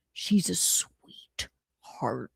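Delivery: slow breathy whisper, very soft; slow whispered impression. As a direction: whisper it slowly like a secret. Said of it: "I was talking like Mersh".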